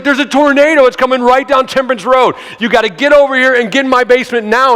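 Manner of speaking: high, loud frantic orders down the phone; acted alarm, shouting instructions; screaming. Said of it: "he's screaming at me".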